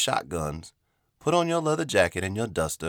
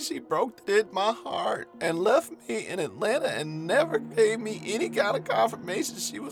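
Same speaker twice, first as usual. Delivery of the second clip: melodramatic lament; 11 semitones higher than usual. Pretend. Mock-dramatic heartbreak lament.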